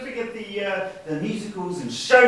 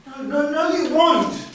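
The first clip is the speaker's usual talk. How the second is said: high, loud, cutting him off; sharp outburst; 12 semitones higher, 4 dB louder than usual. Acted. Snapping at his brother over the will, high and loud. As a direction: shoot him down, high and fast.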